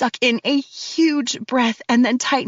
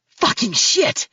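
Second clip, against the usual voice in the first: pained man's curse; slower than usual. Acted. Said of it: "Max screams".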